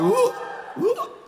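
high, loud whooping call like an Aggie fan. Retold or imitated. Preacher mimicking Aggie fans at games, high whooping call. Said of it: "That's what you do all the time".

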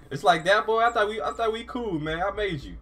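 high, loud playful protest; mock hurt. Real, not acted. Player protests to the game character he created, high and mock-hurt. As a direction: whine it like a wounded parent.

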